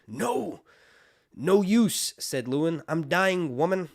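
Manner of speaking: the maester sasses the wildling woman, loud and wry; smartass dying maester. Acted. A smartass maester tells the wildling woman he's dying, loudly. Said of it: "Lewin's being a smartass".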